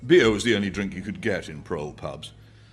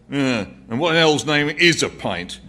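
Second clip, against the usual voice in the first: louder, higher sneering barman; scornful barman; 8 semitones higher, 10 dB louder than usual. A narrator's reading. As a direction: scoff at the old man. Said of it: "said the barman".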